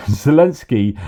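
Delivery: says the name through a laugh. Real, not acted. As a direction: laugh it out, amused. Laughing mock reply.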